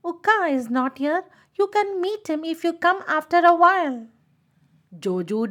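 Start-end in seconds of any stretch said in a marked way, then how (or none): 0.0-4.1 higher disguised voice; irritated bird's put-on voice; changed voice, shouting back. A narrator's reading. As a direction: pretend to be someone else, calling back. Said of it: "changed her voice and shouted back".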